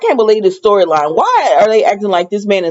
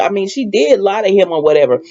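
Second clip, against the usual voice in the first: usual speaking voice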